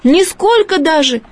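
insist, wounded; offended boy. A narrator's reading. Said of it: "обиженно крикнул мальчик".

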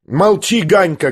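high-pitched shout at a brother; boy's angry cry. A narrator's reading. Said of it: "крикнул опять Коля". (сердитый мальчишеский выкрик)

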